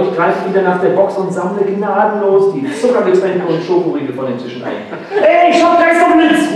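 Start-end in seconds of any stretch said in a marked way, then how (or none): 5.2-6.6 high, eager outburst; excited kid. Acted. Kalle jumping up, high and eager. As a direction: burst out with a bright idea.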